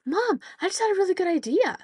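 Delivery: mock excited little girl; excited kid. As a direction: gush like a kid with a plan. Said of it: "And she goes".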